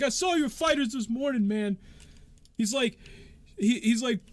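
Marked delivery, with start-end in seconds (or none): 0.0-1.8 accusing. Acted. Accuser argues.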